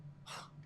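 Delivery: very soft, short exhale; weary sigh. Acted. Crew member giving up on the AI, faint sigh. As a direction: let out a faint breath of defeat. Gives up arguing with AI.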